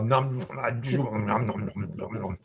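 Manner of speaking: quick mouth-only nom noises; nom nom chomping. Shown as a demonstration. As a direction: show the chomp with just the mouth. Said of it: "It's all just in the mouth".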